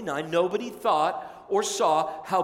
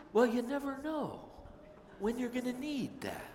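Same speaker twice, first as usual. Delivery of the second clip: grandma's voice; 8 semitones higher, 7 dB quieter than usual. Retold or imitated. Grandma explaining why she saves things.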